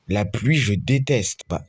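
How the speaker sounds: usual speaking voice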